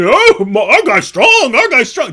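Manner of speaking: mock caveman boast; mock fanboy. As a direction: brag like a meathead fan. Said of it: "And they're saying".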